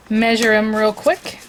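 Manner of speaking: usual speaking voice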